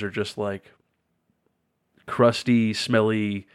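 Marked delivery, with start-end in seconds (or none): none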